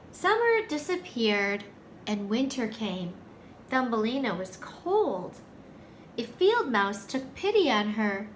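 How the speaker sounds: ordinary delivery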